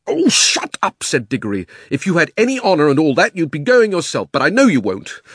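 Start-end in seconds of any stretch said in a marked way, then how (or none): 0.1-1.0 high, loud, exasperated cry; angry outburst. A narrator's reading. Diggory silences his preaching uncle, angry. 1.9-5.2 put-on loud, higher, heated boy; angry accusation. A narrator's reading. Diggory challenges uncle to go himself, indignant.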